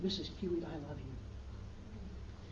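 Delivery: put-on soft, slow, tender little girl; tender child. Little girl hugging her teacher and saying she loves her, soft and slow. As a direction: breathe it out gently while hugging.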